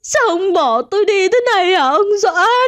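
high, loud and crying; sobbing wail. A narrator's reading. Widow wails over her dead husband, sobbing. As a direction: wail through the tears at the dead body. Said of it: "bà Nga hét lên".

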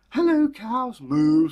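high loud cow greeting ending in a moo; a cheery cow saying hello. Acted. Cow calls out hello to the girl.

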